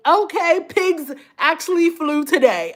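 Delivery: ordinary talk, nothing marked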